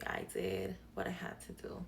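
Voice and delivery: whispered confession, half joking; mock whisper. Joking to viewers, a mock guilty whisper.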